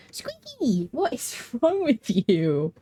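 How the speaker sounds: high drawn-out cry of the cat's name, then slow with a laugh; playful pet scolding. Real, not acted. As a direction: tease the cat with stretched words. Calling to fussing pet cat.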